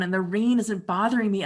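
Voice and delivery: ordinary talk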